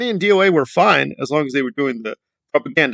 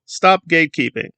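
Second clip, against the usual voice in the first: usual speaking voice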